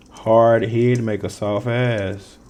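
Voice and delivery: slow, deliberate dictation; measured note-taking read; spelled-out slow saying. Shown as a demonstration. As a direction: say it slowly while writing it down. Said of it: "I'm gonna write that down".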